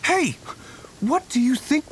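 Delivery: high sharp hey; calling out. Acted. Hunter calling out to travelers, sharp. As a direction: stop them mid-action.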